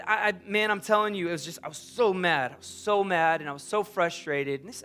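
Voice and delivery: ordinary delivery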